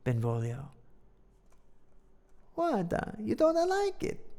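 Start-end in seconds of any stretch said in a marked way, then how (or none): 2.5-4.2 put-on Italian accent. Benvolio answers in his voice.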